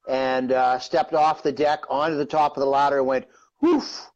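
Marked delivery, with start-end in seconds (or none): 3.6-4.1 short, high-pitched "whoof"; whoof of falling. Retold or imitated. Man telling how he went off the ladder, imitated high whoof. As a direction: imitate the whoosh of a sudden slip.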